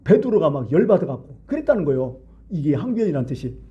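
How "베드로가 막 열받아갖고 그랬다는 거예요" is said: usual speaking voice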